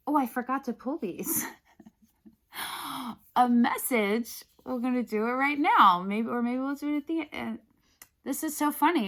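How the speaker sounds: high, laughing surprise at herself; giggly, sheepish realization; amused excitement. Real, not acted. Realizes she forgot cards, laughs.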